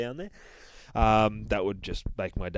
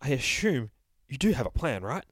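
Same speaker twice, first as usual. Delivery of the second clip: higher, anxious little voice; fussy narrator character; 5 semitones higher than usual. Acted. The narrator relieved the bear is awake, nervous and higher. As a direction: fret with relief, then press for a plan. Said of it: "says the narrator".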